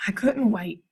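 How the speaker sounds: usual speaking voice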